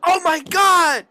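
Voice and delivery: high, loud hammed-up disbelief; mock shocked shout. Player reacting to game character's introduction, loud mock shock. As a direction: shriek it in exaggerated mock shock, stretching the last words.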